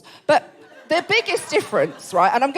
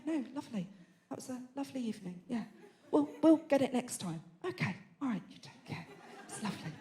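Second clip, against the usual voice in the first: much softer, polite murmured thanks; hushed politeness; 19 dB quieter than usual. Retold or imitated. Comic teller mimicking polite English family thanking in-laws, hushed. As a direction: mumble the English niceties softly. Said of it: "And we all go".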